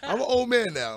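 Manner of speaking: laughing. Real, not acted. Laughing confession.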